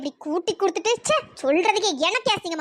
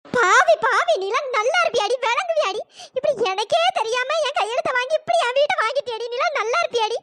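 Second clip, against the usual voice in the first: high-pitched, anguished cursing; wailing curse; 5 semitones higher than usual. Acted. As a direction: curse her as the one who cheated you. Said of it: "உங்க சாபம்லாம் என்னை எதுவும் பண்ணாது".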